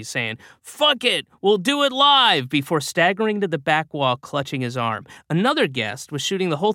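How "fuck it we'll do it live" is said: high, loud exasperated outburst; the host cracks on air, pitched way up; Bill O'Reilly outburst. Acted. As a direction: blurt it out, fed up and reckless. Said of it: "He muttered some obscenity".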